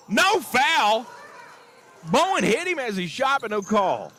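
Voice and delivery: indignant commentator disputing a missed foul; emotional outburst. Real, not acted. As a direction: protest it hotly, easing at the end. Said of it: "I get a little emotional".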